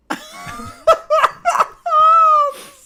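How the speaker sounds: long, loud, high burst of laughing; helpless laughter. Real, not acted. Reacting to the eleven dollar price.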